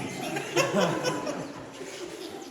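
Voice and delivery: amused chuckle after a joke; soft, breathy laugh. Real, not acted. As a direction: laugh at your own joke. Laughs after his own joke.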